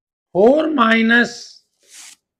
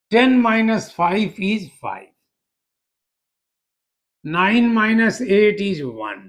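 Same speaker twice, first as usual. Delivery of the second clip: slow dictation; much slower than usual. Shown as a demonstration. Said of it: "teacher dictates slowly".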